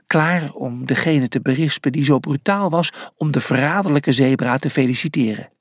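usual speaking voice

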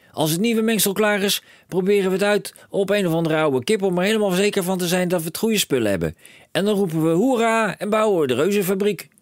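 high and loud, racing with plans; a dad who sees a fortune; excited cry. A narrator's reading. Father telling his son of riches, high excited call.

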